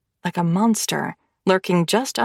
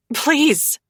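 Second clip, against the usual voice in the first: blurted plea; 5 semitones higher than usual. Acted. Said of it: "I blurt".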